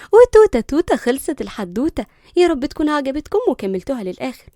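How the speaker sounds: playful fairy-tale ending; storyteller sign-off. A narrator's reading. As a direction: chant the ending like a children's tale. Storyteller's closing rhyme.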